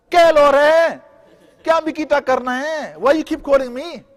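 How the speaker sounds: loud high bratty shout back; rude child yelling. Retold or imitated. Child yelling rudely back at his father, loud and high-pitched. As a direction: snap back at dad like a spoiled kid.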